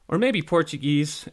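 ordinary delivery

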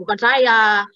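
ordinary talk, nothing marked

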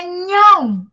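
NHÃO stretched slowly with a nasal ending. Shown as a demonstration. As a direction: draw out "nhão" to show the nasal ão. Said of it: "toda vez que entra o ão". ("nhão" alongado, com final nasal)

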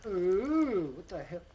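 ordinary talk

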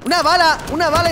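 high, loud gleeful cry; excited shout. Real, not acted. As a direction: call it out, thrilled. Grabbing ammo mid-fight.

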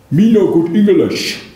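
mock the coffee-seller's broken English; mock foreign accent. Poem mocking the foreign coffeeman, mock foreign accent. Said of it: "the strong accent of the foreigner".

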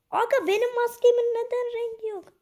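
high, loud astonished question; shocked outcry. Real, not acted. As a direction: cry out in disbelief. Shocked at colorless mask. (yüksek ve yüksek sesli şaşkın soru)

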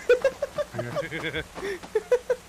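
high breathy giggling fit; helpless high laugh. Real, not acted. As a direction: crack up in high squeals. Laughs after pleading.

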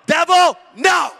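loud, high "Devil, no" shouted down; commanding shout. Acted. Preacher rebuking the devil, shouted command. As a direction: rebuke him with full force.

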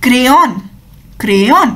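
crayon with an e sound, said clearly; crayon, first vowel like ए; model pronunciation. Shown as a demonstration. Teacher demonstrates crayon with ए sound. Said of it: "it's pronounced as".

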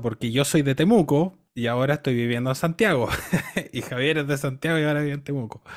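chuckling through the words, amused by the coincidence; laughing. Real, not acted. Pianist telling the host about their swapped hometowns, laughing. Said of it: "esto es lo gracioso".

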